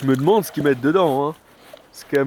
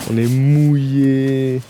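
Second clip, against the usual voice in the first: slow loud call; 8 dB louder, much slower than usual. Real, not acted. Soaked after the hike.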